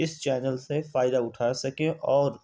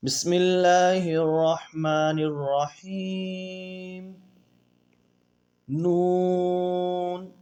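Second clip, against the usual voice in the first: Quranic recitation drill; 2 semitones higher, much slower than usual. Shown as a demonstration. Teacher chanting the lesson's letters for students, drawn out. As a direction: intone each letter slowly like a recitation.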